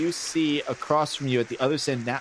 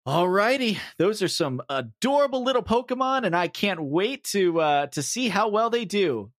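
higher, lilting dad-coach pep talk; hearty praise for the youngsters; corny, wholesome dad voice; encouraging dad gym leader; 5 semitones higher than usual. Acted. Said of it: "Toby goes".

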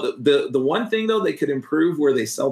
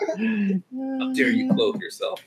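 hammed-up indignation, high, slow and soft through laughter; mock outrage; 12 semitones higher, 5 dB quieter, slower than usual. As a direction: play it as fake scandalized shock while laughing. Mock outrage at joke about favors.

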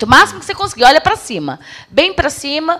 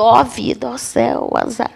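queixume desanimado, cada vez mais baixo; lamento fingido; 2 semitones lower, 7 dB quieter than usual. Shown as a demonstration. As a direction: imite um coitado se lamuriando da vida. Demonstra corpo e fala desanimados. (lamúria encenada, sem energia)

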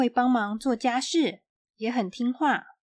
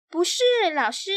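put-on higher girl's voice; 6 semitones higher, 3 dB louder, faster than usual. A narrator's reading. Xiaoqi answers her teacher in a higher girl's voice. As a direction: answer like a cheeky little girl.

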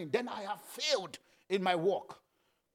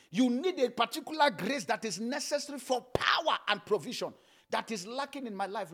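normal talking voice

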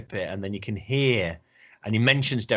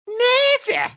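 very high, loud comic outburst; squawked fake spirit voice; mock possession; 7 dB louder than usual. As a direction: burst out as if suddenly possessed, hammed-up. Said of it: "suddenly get into a possession and then suddenly go".

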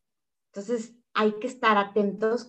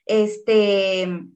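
ordinary talk

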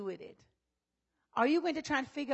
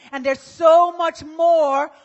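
loud, slow, tearful preaching; preaching at full force; 4 semitones higher, 9 dB louder, slower than usual. Real, not acted. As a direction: cry it out to the congregation. Preaching climax, near tears.

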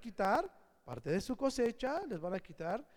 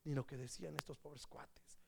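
soft pitying whisper; hushed aside to the listeners; 5 semitones lower, 10 dB quieter than usual. Real, not acted.

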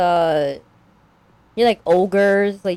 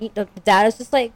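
ordinary delivery

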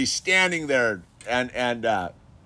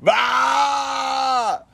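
high, imitated bellow; mock bellow; 12 semitones higher than usual. Retelling the kid's crash, high imitated bellow. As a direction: let out a wail like the crashed kid. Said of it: "He just lets out this bellow".

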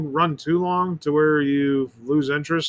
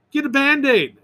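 mother in ad; 8 semitones higher than usual. Retold or imitated. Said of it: "mother who's next to the cat says".

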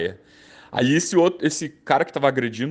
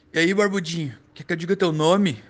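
street man voice; 7 semitones higher than usual. Retold or imitated. Homeless man accosts the narrator.

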